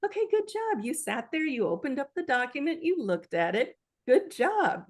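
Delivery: high, gentle praise for yourself; self-encouragement. Pretend. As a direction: cheer yourself on kindly. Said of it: "give myself more credit and say".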